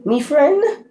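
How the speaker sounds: high, lilting mock Irish "Me friend?". Tarot reader voicing the Irish mob she hears, mock Irish brogue. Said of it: "I just keep hearing that".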